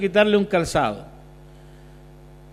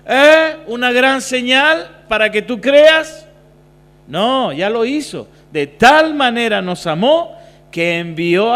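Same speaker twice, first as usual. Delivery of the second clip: preaching at full force; 4 semitones higher, 8 dB louder than usual. Acted. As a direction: hammer it home to the congregation, slowing down.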